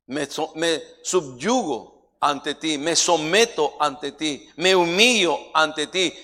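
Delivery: slow, emphatic declaration to God; deliberate, swelling preacher's prayer; preached prayer of surrender. Acted. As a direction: build from measured vows to loud proclamation.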